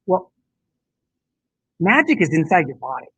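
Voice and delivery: usual speaking voice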